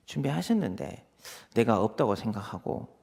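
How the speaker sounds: ordinary talk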